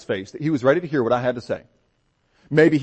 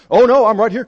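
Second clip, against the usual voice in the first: eager; 9 semitones higher, 8 dB louder than usual. Retold or imitated. Eager reply to the captain.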